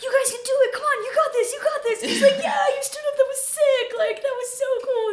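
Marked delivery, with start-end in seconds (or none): 0.0-2.0 high-pitched, giddy imitation of encouragement; excited cheering. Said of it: "he was so excited". 2.4-5.1 high, gushing imitation of an excited coach; excited praise. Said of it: "he's, like, so excited".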